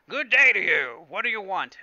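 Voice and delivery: loud, high-pitched hammed-up alien shopkeeper; bad Watto impression. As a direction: greet a customer in a badly faked, booming accent. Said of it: "I can't do Watto's voice".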